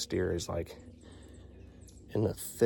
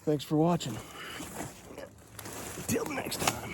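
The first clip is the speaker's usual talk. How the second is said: cheerful sign-off; 8 semitones higher than usual. Real, not acted. Upbeat sign-off.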